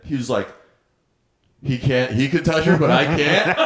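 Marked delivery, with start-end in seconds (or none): 1.6-3.6 louder, higher imitation of indignation, breaking into laughter; mock indignant protest. Drunk guy protests about Pat touching the wife, mock indignant. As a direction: play up the outrage, half laughing.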